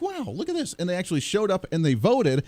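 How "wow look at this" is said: amazed. Retold or imitated. Voters amazed by Trump.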